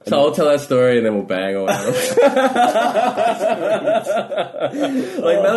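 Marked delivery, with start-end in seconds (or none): 1.9-5.6 high laughter spilling into words; laughing. Real, not acted. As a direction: laugh through the word. Laughing after punchline.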